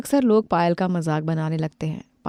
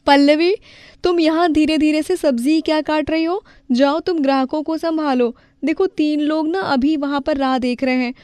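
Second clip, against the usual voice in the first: high, louder commanding; bossy young woman; 8 semitones higher, 3 dB louder than usual. Acted. Vedika orders Pallavi to serve customers, brisk. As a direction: hurry her to the customers.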